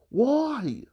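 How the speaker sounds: high, drawn-out wail; exasperated. Real, not acted. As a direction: cry out in frustration. Peak of the rant.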